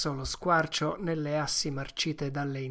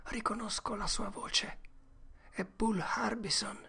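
put-on high, hushed boy's whisper; scared whisper; 7 semitones higher, 5 dB quieter than usual. Tom whispers to Huck, hiding, frightened. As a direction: whisper it so nobody hears.

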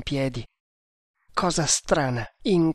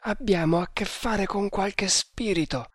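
higher, uneasy voice; frightened servant, pitched up; fearful murmur; 6 semitones higher than usual. A narrator's reading. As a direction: confide a superstitious fear. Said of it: "mormorò Kammamuri".

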